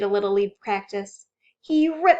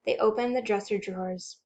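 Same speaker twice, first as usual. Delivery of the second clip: plain reading voice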